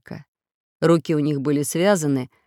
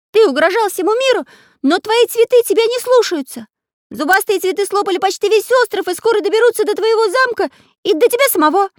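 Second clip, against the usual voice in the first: very high, loud cartoon tirade; shouted defiance; 7 dB louder, faster than usual. A narrator's reading. Pencil character taunts ruler about his flowers, shouting. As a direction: berate the villain at top pitch. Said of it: "выкрикнул Карандаш".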